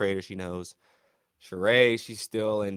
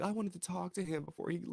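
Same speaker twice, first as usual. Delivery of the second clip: whiny quote; 8 semitones higher, 8 dB quieter than usual. Retold or imitated. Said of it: "They said".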